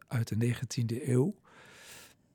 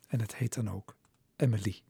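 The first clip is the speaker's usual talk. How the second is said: usual speaking voice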